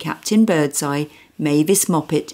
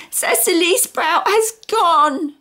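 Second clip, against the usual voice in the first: put-on high, slow crying child; tearful little girl; 13 semitones higher, slower than usual. Small girl telling Mum her doll is gone, tearful. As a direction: sob out the loss. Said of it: "she wailed".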